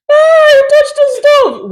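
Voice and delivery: teasing cry. Retold or imitated. Mocking child who touched stove.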